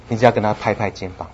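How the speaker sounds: ordinary delivery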